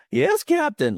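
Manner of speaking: very high, cracking adolescent squeak; really high puberty voice. Pretend. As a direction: pitch it way up, like a boy going through puberty. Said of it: "this really high".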